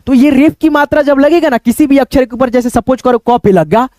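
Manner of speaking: ordinary talk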